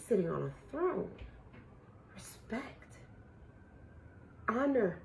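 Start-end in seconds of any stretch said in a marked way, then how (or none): 2.1-3.0 whispered. Real, not acted. Whispered word.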